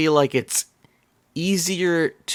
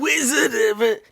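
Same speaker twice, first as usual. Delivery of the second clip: put-on drunk voice; 9 semitones higher than usual. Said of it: "I have to sort of do a drunk voice".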